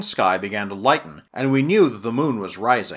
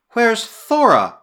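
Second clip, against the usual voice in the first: loud, high question with a drawn-out falling name; urgent cry; 10 semitones higher, 6 dB louder, slower than usual. A narrator's reading. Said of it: "she cried".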